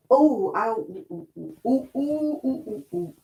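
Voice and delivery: wincing ow and ooh as she moves; real twinges of pain on each move; pained oohs while bending over for the grey yarn. Real, not acted. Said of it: "I feel like somebody stabbed me".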